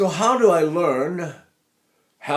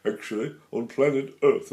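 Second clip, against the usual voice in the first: alien speech; 2 semitones lower, 3 dB quieter than usual. Shown as a demonstration. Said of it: "I say it like this".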